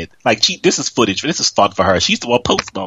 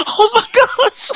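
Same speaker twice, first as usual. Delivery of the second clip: laughing, slow; 13 semitones higher, much slower than usual. Real, not acted. Laughing fit.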